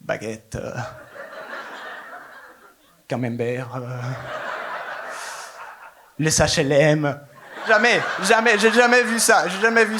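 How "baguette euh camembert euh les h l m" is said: low, soft, slow cliché list; a hint of a sultry whisper; mock flirt. Comedian flirting back with French clichés, low and slow.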